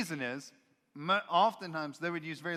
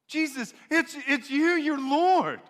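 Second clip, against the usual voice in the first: much higher, louder, slow plea; emotional plea; 11 semitones higher, 8 dB louder, slower than usual. Acted. Pleader at heaven's gate emotionally begging Jesus as Lord. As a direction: beg him to recognize you. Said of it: "very emotionally expressive".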